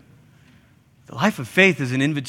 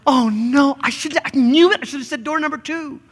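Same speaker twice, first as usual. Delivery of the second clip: mock panic; 9 semitones higher than usual. Panicked believer after hardship.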